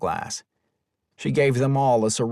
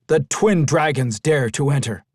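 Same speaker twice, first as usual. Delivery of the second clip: ordinary delivery, nothing marked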